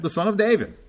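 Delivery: high, quick confident answer; eager answer. Acted. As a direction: answer it high and pat, like know-it-alls. Pharisees' answer acted by preacher.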